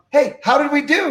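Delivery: high, put-on breezy question; feigned casualness. Caught sneaking out, speaker asks promoter, feigned casualness. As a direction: ask brightly as if nothing happened.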